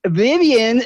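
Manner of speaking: high, loud call across the classroom; calling out a name. Retold or imitated. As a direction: call her name out brightly. Teacher announcing a visitor.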